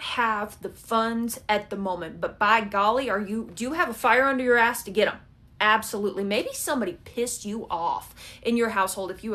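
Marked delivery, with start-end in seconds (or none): none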